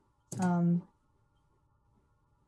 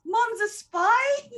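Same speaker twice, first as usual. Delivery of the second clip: shocked; much slower than usual. Real, not acted. Shocked reaction to story twist.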